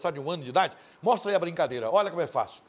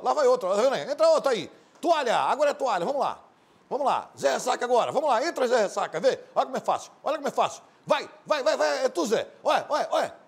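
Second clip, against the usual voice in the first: mock game-show hype; 9 semitones higher, 4 dB louder, faster than usual. Hyping a game clip.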